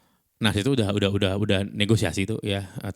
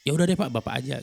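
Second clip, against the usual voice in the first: higher-pitched irritated brush-off; retold unpleasant tone; 6 semitones higher than usual. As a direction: mimic an annoyed retort to a client, pitched up. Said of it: "dengan intonasi yang sangat tidak enak". (menirukan nada kesal yang tidak enak, meninggi)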